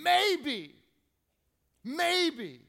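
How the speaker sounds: high, loud cry that falls away; desperate cry. Retold or imitated. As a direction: call it out like a desperate plea. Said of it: "it's this animalistic cry".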